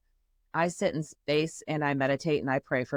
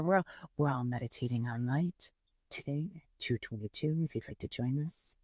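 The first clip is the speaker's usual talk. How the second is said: soft yogi invitation; 5 semitones lower, 7 dB quieter than usual. Retold or imitated. Quoting yogis' soft announcement.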